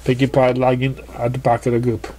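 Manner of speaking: ordinary delivery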